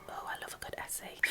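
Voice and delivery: a hushed, dry aside under the breath; sarcastic aside. Acted. Poet mocks the lecturer in a soft sarcastic aside. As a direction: mutter it softly as a mocking side comment.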